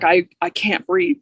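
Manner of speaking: ordinary talk, nothing marked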